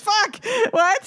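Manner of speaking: high sharp yelp of pain; sudden pained shout. Retold or imitated. Quoting the singer's outburst over hot wings, a sudden high yelp. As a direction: blurt it out as if the hot sauce finally hit. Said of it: "until he finally goes".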